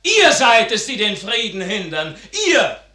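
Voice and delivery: a son shouting down his accusers; angry accusation. Acted. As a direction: hurl the blame at them. Son accuses court in Vienna.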